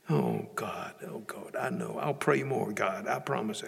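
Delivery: hushed, low pleading under the breath; contrite prayer. Retold or imitated. Praying to God after being accused, hushed contrition. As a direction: murmur an apologetic promise. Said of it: "I had this conversation with God".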